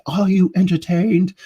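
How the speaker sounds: high, loud mock-epic cry; pitched far up, playful grandstanding; theatrical shout. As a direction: call it out to a whole arena, hammed up. Movie-style repeat of the question.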